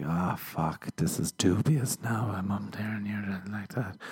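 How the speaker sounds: imitating a lost voice, fading to a whisper; weak mock voice loss, sinking into whisper; voice gone. Shown as a demonstration. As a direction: act as if your voice is giving out. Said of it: "But if my voice is gone".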